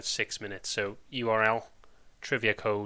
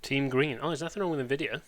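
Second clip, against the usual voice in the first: ordinary talk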